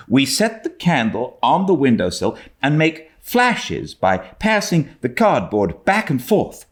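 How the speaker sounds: put-on high, quick girl's voice explaining; a proud girl sharing her secret; eager young Anne. A narrator's reading.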